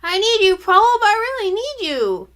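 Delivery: put-on high, loud howling boy; little brother howling that he needs his sister, high and loud. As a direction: howl it desperately. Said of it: "howled louder".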